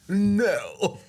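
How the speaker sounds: high cartoon "no" in a put-on voice; Bugs Bunny. Co-host quoting the meme to friend, cartoonish denial. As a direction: pitch it up like a meme refusal. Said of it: "Bugs Bunny meme".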